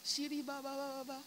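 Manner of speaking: quick, soft babble of prayer syllables; retold rapid praying. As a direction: rattle it off under your voice. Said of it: "my mouth is going".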